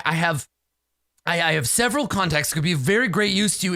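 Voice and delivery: high-pitched, stammering plea; panicked blubbering. Acted. Captive begging his supposed rescuer to free him, blubbering. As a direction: babble and beg in a high frantic voice. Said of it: "he starts blubbering".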